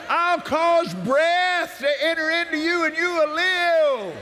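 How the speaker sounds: measured devotional scripture reading; scripture proclamation. Acted. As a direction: proclaim each verse as a promise. Reads healing scriptures aloud.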